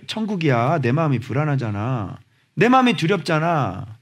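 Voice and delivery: ordinary delivery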